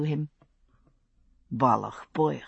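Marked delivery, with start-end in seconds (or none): 1.5-2.4 low murmur, soft Highland voice; murmured Gaelic. A narrator's reading. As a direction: croon it low to the baby. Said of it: "Jamie murmured".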